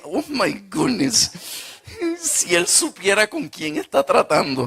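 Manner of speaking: laughing oh my goodness; laughing disbelief. Retold or imitated. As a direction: laugh while gasping in disbelief. Amused disbelief at the plumber.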